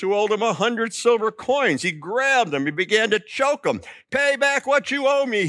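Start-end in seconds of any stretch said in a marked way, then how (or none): 4.1-5.5 higher, mock demanding servant; lightly voiced, a hint of a threatening demand. Servant choking his fellow servant, a touch of a mock demand. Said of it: "he demanded".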